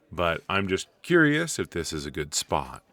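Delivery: ordinary delivery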